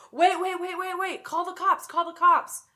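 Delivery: high, loud desperate yelling; frantic shout. Acted. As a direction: scream a warning. Trucker screaming in story.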